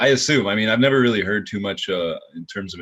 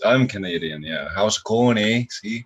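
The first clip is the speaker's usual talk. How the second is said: hammed-up Canadian accent, louder; mock Canadian accent; 4 semitones higher, 5 dB louder than usual. Showing off a Canadian accent for the hosts, hammed-up Canadian. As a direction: play the cheery Canadian with a Canadian accent. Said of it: "this is what my Canadian accent is about".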